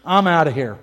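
low, final mock ultimatum; mock walkout. Preacher acting a listener who quits, mock ultimatum. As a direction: deliver it as a critic's curt verdict. Imitated critic storms off.

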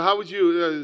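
ordinary talk

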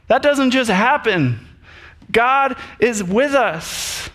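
a tired leader reminding grumblers of miracles; exasperated Moses. Retold or imitated. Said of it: "He's like".

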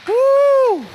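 high whooping cheer of delight; loud woo. Real, not acted. Preacher whoops at the Patriots losing, loud and high. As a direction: whoop like the crowd just scored.

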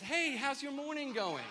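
high, sing-out cheery greeting; breezy high-pitched morning hello; Meredith. Retold or imitated. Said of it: "Meredith comes down the stairs".

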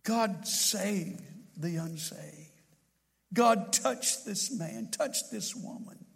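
hushed, slow pleading prayer to God; softly pleading prayer, almost a whisper; fervent whispered prayer. Shown as a demonstration. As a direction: pray it under your breath, slowly and earnestly.

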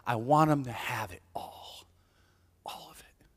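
tender hush, almost voiceless at the end; hushed devotion. Acted. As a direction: let it sink into a reverent whisper. Voicing the woman's devoted thought.

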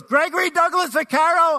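loud, high scolding holler; imitated angry mom's yell; mom yelling full name. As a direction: shout the full name as a warning. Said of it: "my mom yelled out the back door".

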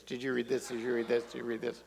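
soft, deadpan mock interrogation; imitating the sketch. Pastor recalls family chats, softly mimicking the sketch. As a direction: quietly parody the nagging question. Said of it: "that sounded like our conversations".

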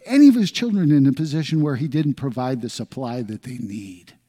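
soaring sermon delivery; fervent preaching. Acted. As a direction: preach it out to the congregation. Preaching at full force.

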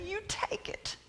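high, very soft plea; hushed surrender. Acted. Preacher setting the burden down before God, hushed surrender. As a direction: hand it over in a near whisper.

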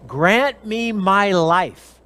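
slow, drawn-out plea; pleading queen. Acted. Esther pleads with the king for her life, slow. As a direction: stretch each word like a plea for life. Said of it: "She said".

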